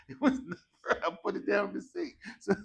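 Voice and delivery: real laughter interrupting the story; laughing. Real, not acted. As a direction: tell it while cracking up. Laughing through anecdote.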